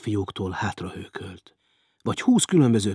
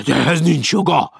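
loud, higher outraged protest; outraged roar; 6 semitones higher, 8 dB louder than usual. Acted. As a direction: roar it out in indignation. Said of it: "hördült fel Hecker".

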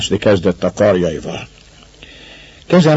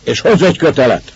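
raised commanding call; 6 semitones higher, faster than usual. A narrator's reading. Master calling to Viktor for a rope, calling up. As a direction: call the order up to the loft. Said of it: "kiáltott föl Viktornak az úr".

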